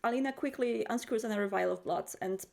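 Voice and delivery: normal talking voice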